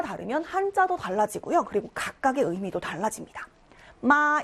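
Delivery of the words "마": Mandarin first tone on 마. Shown as a demonstration. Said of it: "일성으로 읽으면".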